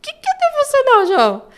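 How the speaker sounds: high, loud, laughing disbelief; surprised question. Retold or imitated. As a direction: ask it amazed and half-laughing. Mother surprised by son's word.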